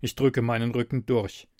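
ordinary delivery

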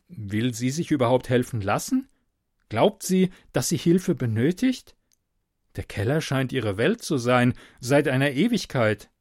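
ordinary delivery, nothing marked

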